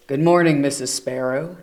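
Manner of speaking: gruff bear voice greeting a neighbour; grumpy bear Bruce. Acted.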